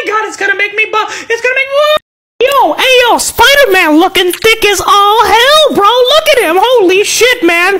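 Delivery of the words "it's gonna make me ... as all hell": ordinary talk, nothing marked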